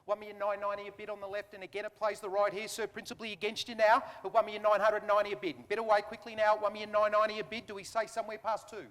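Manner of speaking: brisk auction patter; auctioneer calling. Real, not acted. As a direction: rattle off bids like an auctioneer.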